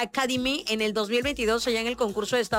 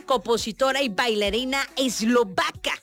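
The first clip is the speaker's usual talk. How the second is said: normal talking voice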